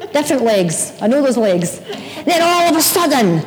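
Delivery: ordinary delivery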